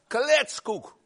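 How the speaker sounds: loud, high cry of dismissal; indignant outburst. Real, not acted. Preacher to congregation, scoffing at faith being boring, loud and indignant. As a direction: blast the word away, loud and scornful.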